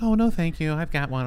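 a hint of a sweet customer; lightly voiced client. Client voiced in salon exchange.

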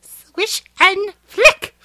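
high, very slow, prissy teacher imitation; prim teacher. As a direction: imitate the fussy charms teacher, drawn out. Quoting the wand lesson line.

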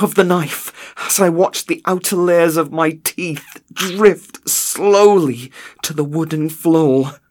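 high, loud voice strained with pain; anguished. A narrator's reading. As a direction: read it as if still in agony. Narrator reliving torture in agony.

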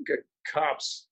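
usual speaking voice

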